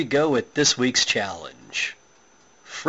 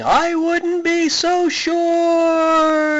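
very high, slow scream; 4 dB louder, much slower than usual. A narrator's reading. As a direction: shriek it while falling.

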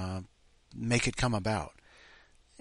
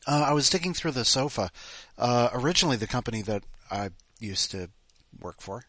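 ordinary talk

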